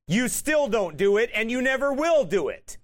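loud, high fervent preacher; preaching at full force. Acted. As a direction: thunder it from the pulpit. Preacher building on the point.

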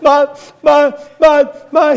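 high, loud, broken-up stammering; barely getting the words out through tears; choked-up stammer. Retold or imitated. Preacher retelling his grief to the neighbor, high choked stammer. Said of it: "I said".

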